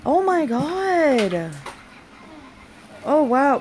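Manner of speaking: slow drawn-out high oh my god; amazed. Real, not acted. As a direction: let the awe stretch the words. Amazed at box contents.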